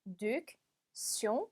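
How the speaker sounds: "réduction" very slowly, a pause after each syllable; broken into syllables. Shown as a demonstration. Teacher helping learners with a hard word, "réduction" split syllable by syllable. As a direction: say "ré... duc... tion" in separate pieces. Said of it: "끊어서 읽어볼게요".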